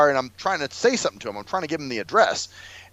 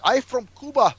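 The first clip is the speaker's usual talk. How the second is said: higher mock Cuban accent; 8 semitones higher than usual. Mocking the driver's excuse, higher mock Cuban accent. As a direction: claim it in broken English, put-on. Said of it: "he was like".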